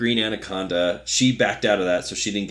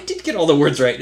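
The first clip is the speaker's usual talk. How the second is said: loud, fast, higher indignant protest; defensive retort; 6 semitones higher, 8 dB louder, much faster than usual. Retold or imitated. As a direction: insist loudly and quickly, offended.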